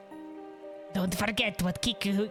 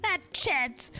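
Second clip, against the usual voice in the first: villain voice; 7 semitones higher than usual. Acted. Villain exclaims.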